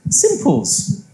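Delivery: high mock Russian meerkat "Simples", falling; meerkat catchphrase. Guest recalling the advert catchphrase, imitating the meerkat. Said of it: "everyone was saying fucking simples".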